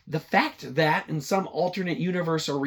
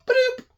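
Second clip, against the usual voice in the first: cartoon sound effect; 6 dB louder than usual. Sound of food delivery.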